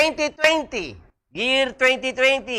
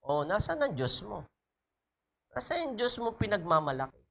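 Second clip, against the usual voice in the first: hushed, mocking taunt, much softer and lower; a quiet, jeering voice of the enemy; taunting enemy whisper; 3 semitones lower, 11 dB quieter, faster than usual. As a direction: sneer it under your breath like a tempter. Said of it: "the enemy whispering and taunting you".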